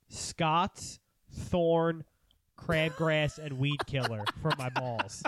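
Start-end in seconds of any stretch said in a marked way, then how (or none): none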